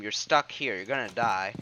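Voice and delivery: normal talking voice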